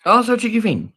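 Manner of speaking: high, loud delighted coo; adoring squeal. Real, not acted. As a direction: squeal fondly at a cheeky pet. Cooing while replaying monkey video.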